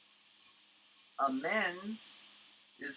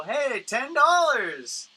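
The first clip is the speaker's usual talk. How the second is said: higher, louder, slow barker's pitch; caricature hawker calling out; 10 semitones higher, 9 dB louder, slower than usual. Retold or imitated. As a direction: hawk it like a pier street artist. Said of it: "and they go".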